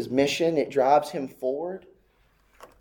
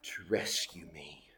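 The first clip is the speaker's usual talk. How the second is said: breathy whisper, much softer; whispered plea; 11 semitones lower, 17 dB quieter than usual. Acted. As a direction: let the plea die to a whisper. Pleading sinner's prayer fading out.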